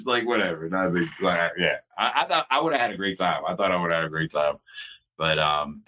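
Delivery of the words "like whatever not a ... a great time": ordinary talk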